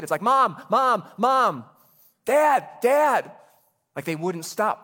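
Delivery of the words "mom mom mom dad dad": put-on high, loud child calling for a parent over and over; nagging small kid. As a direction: whine for Mom like a kid who won't stop. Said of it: "if you're a parent of a small kid".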